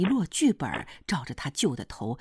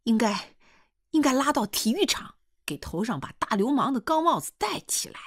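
high-pitched angry woman's tirade in dialect; scolding rant, pitched well above narration; 9 semitones higher than usual. A narrator's reading. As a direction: lash out with escalating scorn. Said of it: "就听胡彩香又乱嚼乱骂起来".

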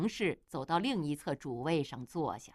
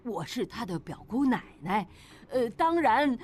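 defensive blustering; 3 semitones higher, 3 dB louder than usual. A narrator's reading. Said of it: "强词夺理道".

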